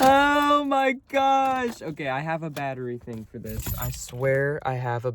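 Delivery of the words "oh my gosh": high, drawn-out wail; long high-pitched cry of exasperation; drawn-out exclamation. Real, not acted. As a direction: stretch it out way up high. Car trouble at the start.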